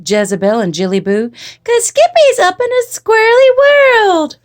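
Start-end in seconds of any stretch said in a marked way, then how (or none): 1.6-4.4 high, loud sisterly chant; singsong sisters. A narrator's reading. Sisters telling on Skippy up on the wire, high singsong. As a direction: sing it out in a little-girl voice. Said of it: "sang his sisters".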